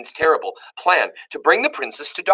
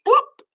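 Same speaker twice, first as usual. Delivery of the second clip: plop sound; 4 dB louder than usual. A narrator's reading. Sound word read.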